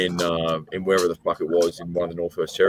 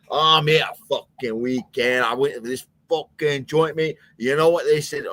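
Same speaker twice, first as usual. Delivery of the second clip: higher-pitched, garbled Glasgow brogue; unintelligible Glaswegian accent; 6 semitones higher than usual. Retold or imitated. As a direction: ramble excitedly in impenetrable Glaswegian. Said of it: "Scott, I'm not getting anything".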